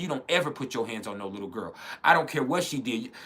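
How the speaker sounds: ordinary talk, nothing marked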